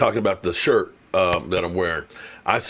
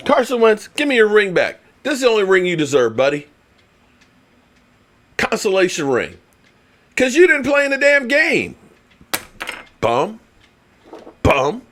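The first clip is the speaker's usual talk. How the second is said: higher, louder taunting rant; mock trash talk; 9 semitones higher, 3 dB louder than usual. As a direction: taunt him like a heckler. Acted trash talk at Wentz.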